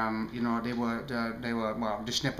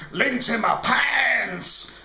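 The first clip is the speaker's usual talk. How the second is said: slow, drawn-out shouted catchphrase, higher; Shay Carl impression; 10 semitones higher, 6 dB louder, much slower than usual. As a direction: belt it out like a hyped vlogger. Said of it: "like Shay Carl do".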